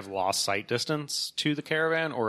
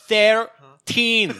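THIRTEEN much higher and louder, heavily Scottish; exaggerated Scottish accent; 11 semitones higher, 8 dB louder than usual. Scot repeats the floor to a deaf elevator, exaggerated Scottish accent. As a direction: belt out "Thirteen" as an exasperated mock Scot. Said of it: "understand a Scottish accent".

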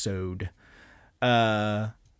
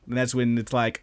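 usual speaking voice

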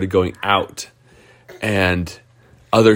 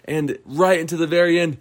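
much higher, rising to a gleeful cheer; thrilled narration; 9 semitones higher than usual. A narrator's reading. Storyteller building the race finish to a child, much higher and thrilled. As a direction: build the finish like a sports call.

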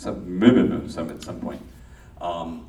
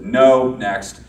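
put-on loud, clipped casting director brushing a kid off; casting director waving off a nine-year-old, loud and curt; curt rejection; 6 semitones higher, 13 dB louder than usual. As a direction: dismiss him fast and loud, higher up. Casting person rejecting a child.

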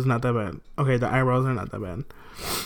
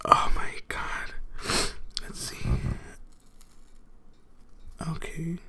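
whispering; 3 semitones higher, 8 dB quieter, slower than usual. Real, not acted. Peeling painful face mask alone.

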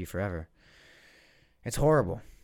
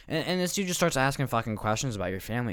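usual speaking voice

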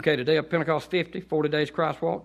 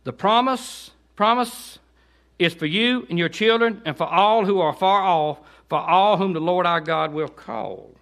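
raised preaching read; preaching scripture; 6 semitones higher, 7 dB louder, slower than usual. Acted. Preacher reading scripture to the congregation, fervent. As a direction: proclaim the verses with fervor.

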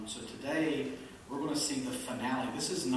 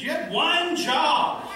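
much higher and louder, slow, ONE stretched; exasperated; 13 dB louder, slower than usual. Real, not acted. Pastor to congregation, loud slow mock exasperation.

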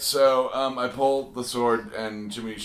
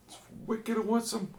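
put-on Boston accent; 11 semitones higher, 5 dB quieter than usual. Boston-ish high voice.

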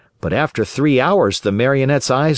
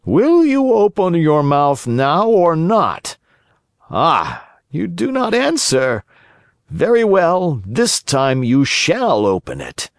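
menacing assassin; 6 semitones higher than usual. A narrator's reading. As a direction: press the prisoner for an answer.

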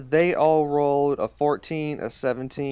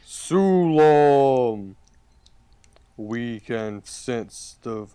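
talking tree; 2 semitones lower, 5 dB louder, much slower than usual. Acted. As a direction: speak as an ancient living tree. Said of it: "It goes".

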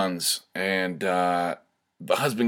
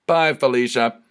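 punchy, louder, higher Bye, Felicia with a hit on Bye; sassy dismissal; 6 semitones higher, 6 dB louder than usual. Shown as a demonstration. Comedian to audience, a loud sassy Bye, Felicia. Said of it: "I should have said".

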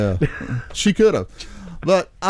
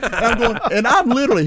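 ordinary talk

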